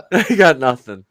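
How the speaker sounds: loud, exasperated give-up; exasperated loud repeat. Real, not acted. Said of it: "Literally nothing at this point".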